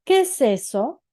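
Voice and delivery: storyteller's exclaimed question; exclaiming. A narrator's reading. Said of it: "exclamó".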